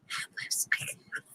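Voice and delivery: soft unvoiced whisper; whispering to herself in the quiet. Real, not acted. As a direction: breathe the words out in a whisper. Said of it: "Yes, I'm whispering".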